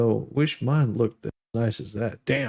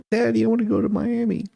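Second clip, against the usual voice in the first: high, quick whiny begging to daddy; spoiled daughter; 8 semitones higher, faster than usual. Pretend. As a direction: wheedle like a spoiled girl asking daddy for a trip. Joke retold, daughter begging dad.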